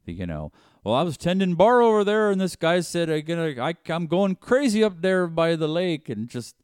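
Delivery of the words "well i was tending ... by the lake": high, loud mock Fargo accent. Acting out a Fargo local telling what happened, Fargo accent. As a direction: send up the Fargo accent, pitched well up and louder. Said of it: "It's the accents".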